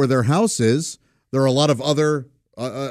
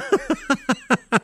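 high, rapid chuckle; a real run of chuckles; laugh; 12 semitones higher than usual. Real, not acted. Recalling childhood Fourths, amused chuckling.

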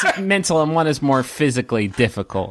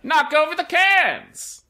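high, drawn-out barker's call; enticing game vendor; 12 semitones higher, slower than usual. Acted. Vendor explaining the rules, high drawn-out call. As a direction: stretch it out in a high hawker's voice. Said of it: "one particularly enticing vendor who's".

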